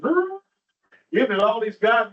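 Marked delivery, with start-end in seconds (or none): 0.0-0.4 high mock growl of an old dog. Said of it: "the dog says".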